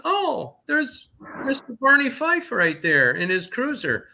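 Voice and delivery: high, sardonic discovery; wry surprise. Retold or imitated. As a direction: spot the cop with scorn. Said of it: "retold trucker spotting cop".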